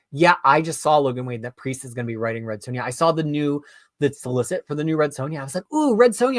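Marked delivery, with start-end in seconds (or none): 5.7-6.4 higher-pitched delighted ooh; eager, high-pitched fan reaction; excited reaction. Retold or imitated. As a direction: re-enact perking up at welcome news. Recalls eager first reaction to news.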